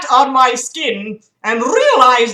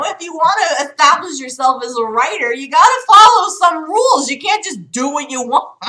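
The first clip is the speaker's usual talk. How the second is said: preachy lecture; 6 semitones higher, 4 dB louder than usual. Real, not acted. Lecturing the writer.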